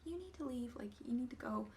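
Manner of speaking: hushed, half-whispered order; imitating a timid coworker; soft-spoken coworker. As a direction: say it softly like a shy clerk. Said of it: "quiet and shy and, and very soft spoken".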